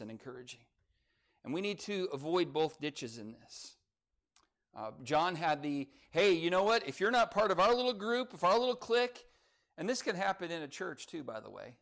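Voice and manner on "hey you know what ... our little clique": higher-pitched, pushy insider voice, lightly acted; mock cliquish challenge. Clique member warning an outsider, mildly mock-pushy. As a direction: lay down the group's terms, building higher. Voicing an exclusive church clique.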